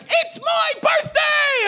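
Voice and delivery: very high, slow, drawn-out shriek; piercing stretched-out mock screech; imitated drunken birthday scream. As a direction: scream it like a party girl in the back seat. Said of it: "screaming and yelling".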